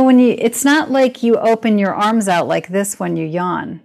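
normal talking voice

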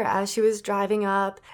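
ordinary delivery

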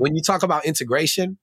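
normal talking voice

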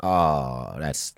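ordinary talk, nothing marked

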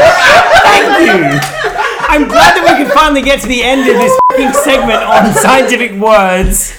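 high, loud, laughing outburst; amused, fed-up yell; exasperated relief. Real, not acted. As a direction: shout thanks as if at long last. Mock-relieved rant after chaotic segment.

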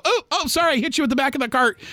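high, quick, breezy "oh, sorry"; mock careless apology. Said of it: "they're just walking and go".

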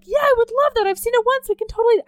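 high, overeager agreement; fake enthusiasm. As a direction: feign delight. Her own fake enthusiasm.

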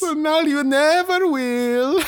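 put-on very high, slow Italian accent breaking into a laugh; mock Italian accent, laughing. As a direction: gloat in cartoon Italian while laughing. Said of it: "accents that alienate audiences".

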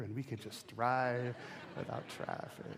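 daydream it under your breath; dreamy whispered trail-off; wistful hush. Real, not acted. Wistful dream of clear freeways.

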